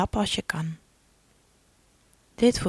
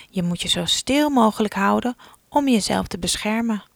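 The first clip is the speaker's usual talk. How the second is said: usual speaking voice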